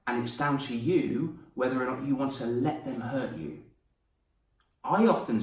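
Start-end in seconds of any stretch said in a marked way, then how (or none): none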